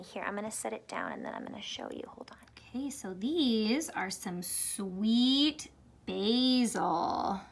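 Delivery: hushed whisper over tiny seeds. Real, not acted. Teacher whispering to students over tiny seeds. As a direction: whisper as if the seeds might blow away.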